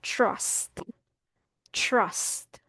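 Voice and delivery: "Trust" as "chrust", softly, with a CH-like TR; model TR sound. Shown as a demonstration. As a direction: say "Trust" quietly with the American chr onset. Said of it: "pronunciation po natin dito is like this".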